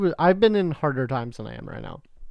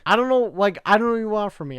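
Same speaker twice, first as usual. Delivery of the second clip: much higher and louder, flustered whine; mock flustered self; 8 semitones higher, 5 dB louder than usual. To the bill collector, a high flustered whine. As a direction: squeak out a frazzled excuse. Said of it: "this is me saying it".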